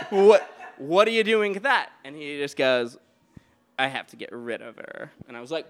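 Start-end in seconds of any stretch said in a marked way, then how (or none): 0.1-1.9 high, loud retold alarm with laughter; alarmed disbelief. Confronting the man with the hammer, high, loud alarm. 3.8-5.1 lightly imitated low, eerie line; haunting voice. Frank explaining the hammer, low and haunting. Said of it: "haunting voice".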